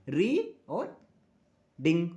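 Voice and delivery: "री और डिंग" with each syllable set apart by a pause; syllable split. Shown as a demonstration. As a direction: say री, pause, then ding as separate beats. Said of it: "Reading has two syllable".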